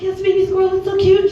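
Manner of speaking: mock cooing. Cooing back at the mom.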